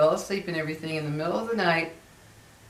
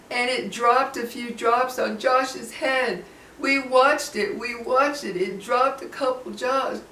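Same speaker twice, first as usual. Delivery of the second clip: put-on higher, slow, frightened little boy; shaking child; 6 semitones higher than usual. Retelling the shaking boy describing the tube, higher and slow. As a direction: tell it like a trembling kid. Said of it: "he was shaking".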